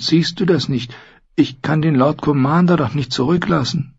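high, pleading protest; self-doubting young man. Acted. Voices self-doubting Sam.

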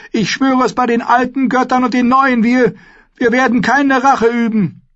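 high, loud, urgent begging; desperate pleading. A narrator's reading. Mother begs for her firstborn, desperate plea. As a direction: plead and swear for his life.

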